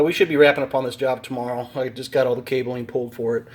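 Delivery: ordinary talk, nothing marked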